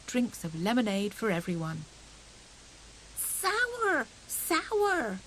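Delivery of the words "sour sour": high squeaky complaint; grimacing child. A narrator's reading. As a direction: pull a face at the sour drink. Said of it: "grimaced little Fuzzy Peg".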